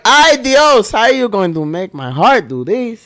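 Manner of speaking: high, hammed-up plea; mock telenovela anguish. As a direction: plead with the character in playful melodrama. Hams up a Riverdale viewer's anguish.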